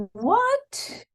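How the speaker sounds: a startled what leaping very high; high, stunned what; shocked what. Retold or imitated. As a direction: gasp it out in disbelief. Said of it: "And I went".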